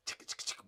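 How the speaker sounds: whispered mock gun-cocking sound; unvoiced imitated click of weapons; gun cocking noise. Imitating guards loading guns.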